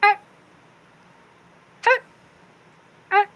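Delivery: short, sharp imitated raccoon calls; raccoon noises. Player as August trying to befriend raccoons, chirping like one. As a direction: make little animal chirps, one by one. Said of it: "he's looking at them and he's like".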